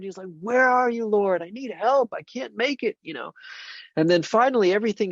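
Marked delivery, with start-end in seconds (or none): 0.4-3.0 crying out. Retold or imitated. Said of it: "he's crying out to the Lord".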